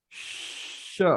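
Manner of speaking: "श" as sh, tongue drawn back; soft, long sh in "श"; SH sound demo. Shown as a demonstration. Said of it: "और हम श बोल रहे हैं". ("श" जीभ पीछे करके)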